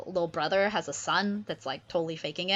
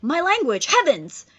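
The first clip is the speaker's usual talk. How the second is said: put-on higher, louder, grand young prince; Ferdinand's voice; 10 semitones higher, 6 dB louder than usual. Ferdinand hears his own language, grandly excited. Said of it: "Ferdinand's like".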